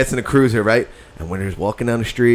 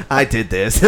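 giggling; 4 semitones higher, 7 dB louder than usual. Retold or imitated. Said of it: "He's like".

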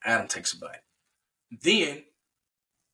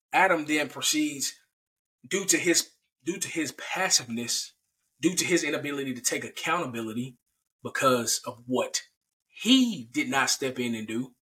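ordinary delivery

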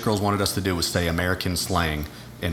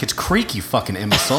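put-on mean girlfriend. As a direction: imitate an exasperated girlfriend, exaggerating the meanness. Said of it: "I'm making her sound way more mean".

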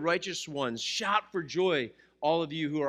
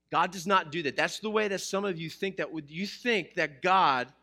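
usual speaking voice

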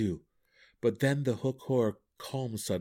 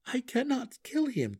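high-pitched, pleading refusal; anguished refusal. A narrator's reading. Drizzt refuses to kill his friend, anguished refusal. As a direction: say it high, without anger. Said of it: "he was no longer angry".

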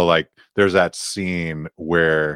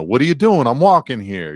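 tough New Jersey guy; 7 semitones higher, 5 dB louder, faster than usual. Retold or imitated. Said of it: "trying to, like, be sarcastic".

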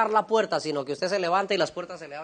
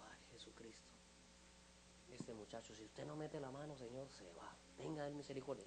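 hushed worried prayer; 2 semitones lower, 20 dB quieter than usual. Retold or imitated. Shaken pastor leaves the ward begging for mercy, hushed prayer. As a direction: murmur it privately, barely audible.

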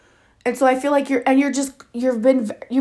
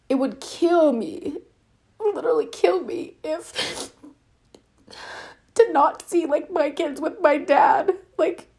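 tearful; 5 semitones higher, 3 dB quieter than usual. Real, not acted. Wife talking about father and kids.

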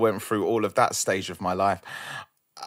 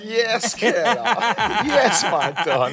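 laughing; 12 semitones higher, 8 dB louder, slower than usual. Real, not acted. Guest greeted, laughing hype.

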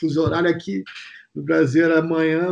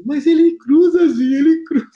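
high, louder, whiny insistence; mock tearful protest; 10 semitones higher, 6 dB louder than usual. Júnior pleading with Zinho at night, high whiny protest. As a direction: cry it out like a frightened kid. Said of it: "o Júnior chorando".

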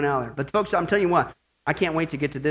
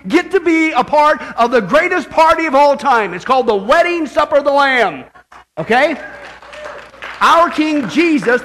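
loud, raised, exultant sermon; full-force preaching; 9 semitones higher, 11 dB louder than usual. Real, not acted. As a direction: proclaim good news with booming excitement.

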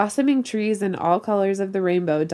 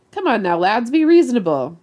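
louder, higher pleading; begging; 4 semitones higher, 7 dB louder than usual. A narrator's reading. Captain trying to sway the glaring crew, begging. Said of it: "Snake tried begging".

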